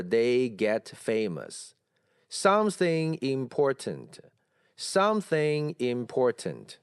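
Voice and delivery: very slow word-by-word English with every word heavy; deliberately awkward, all stressed. Shown as a demonstration. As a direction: drag out each word with no weak forms. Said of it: "这么难听的英文". (故意难听：逐词重读，拖得很慢)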